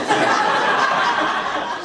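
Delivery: breathy high burst of laughter; high unvoiced laugh. Real, not acted. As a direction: crack up high and airy. Laugh burst after quote.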